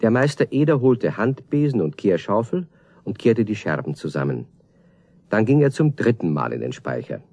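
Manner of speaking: ordinary talk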